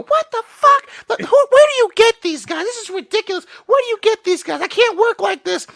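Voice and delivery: high, loud, fed-up woman's voice; indignant woman. Retold or imitated. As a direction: squeal it like the woman in the movie who's had enough. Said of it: "you hear her go".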